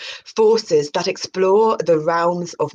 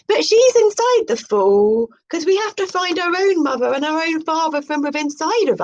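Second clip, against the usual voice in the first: high, airy parody of a mystic presenter; mock spiritual host; 10 semitones higher than usual. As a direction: announce it like a dreamy new-age show host. High mystic aside again.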